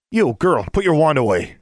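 loud, high order; stern command. Acted. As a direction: bark at her to obey. Auror orders Alexandra.

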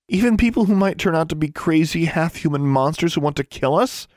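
high, rising, indignant disbelief; high-pitched, exasperated query; incredulous question. Acted. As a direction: ask it in outraged disbelief, pitch climbing at the end. Incredulous retort, echoed by brother.

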